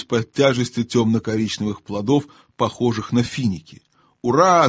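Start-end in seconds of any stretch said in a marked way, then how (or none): 4.2-4.7 loud, higher-pitched cheer; a short, exultant cry; excited shout. A narrator's reading. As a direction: shout hurrah for joy. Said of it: "закричал Дигори".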